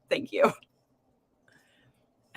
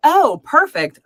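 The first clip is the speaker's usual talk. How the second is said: delighted; 9 semitones higher, 9 dB louder, slower than usual. Real, not acted. Relieved reaction to safe house.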